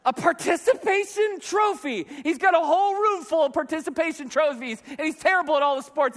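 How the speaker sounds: high-pitched scornful disbelief; exasperated rant. Acted. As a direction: sound driven nuts by the very idea. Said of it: "It drives me nuts".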